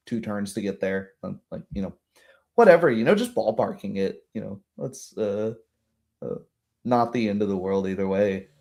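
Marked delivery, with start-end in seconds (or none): none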